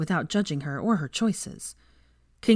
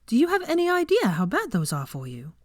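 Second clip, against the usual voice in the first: put-on a much higher young woman's voice, huffy; higher-voiced heroine, scolding; Hermione, indignant; 7 semitones higher than usual. A narrator's reading. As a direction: bristle at him with a high, prim retort.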